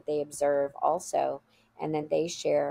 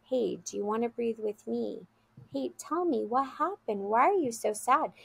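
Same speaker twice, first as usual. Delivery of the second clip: gentle, higher-pitched imitation of a child; calm, caring child; 5 semitones higher than usual. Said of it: "he has to stay calm for her".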